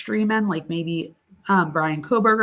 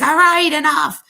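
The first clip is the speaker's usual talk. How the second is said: higher, louder, sharp "enough"; raised voice; 8 semitones higher, 7 dB louder than usual. Retold or imitated. Mom raising her voice at daughter, loud and sharp. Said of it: "I raised my voice".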